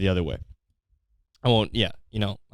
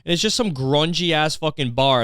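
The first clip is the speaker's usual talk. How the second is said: ordinary talk, nothing marked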